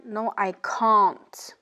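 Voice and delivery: British "can't" with a long open a, like "cahn't"; British accent. Shown as a demonstration. Teacher contrasting British with American for learners, British accent. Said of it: "En Angleterre, on dira plutôt".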